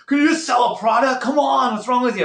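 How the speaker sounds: high, loud, impatient yelling at a seller; pushy sales manager. Retold or imitated. Sales manager scolding his team to sell, loud and high impatient shout. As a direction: berate the rep like a pushy manager. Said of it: "I've heard these people talk like this".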